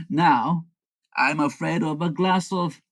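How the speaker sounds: ordinary delivery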